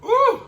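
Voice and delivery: loud high-pitched ooh; high ooh from the heat. Real, not acted. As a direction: yelp as the burn hits. Reacting to spicy heat.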